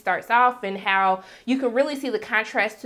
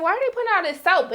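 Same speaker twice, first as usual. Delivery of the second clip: confused question; 10 semitones higher, faster than usual. Retold or imitated. Confused reaction to the soap.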